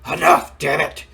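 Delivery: a father snapping at his arguing son; angry shout. Retold or imitated. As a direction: slam the table and bark it out. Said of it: "he gets mad".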